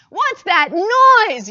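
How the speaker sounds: high, loud, slow alarmed yell. Acted. Startled hawks yelling over the drumming, loud and high. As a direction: cry out in alarm at the noise. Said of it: "yelled the hawks".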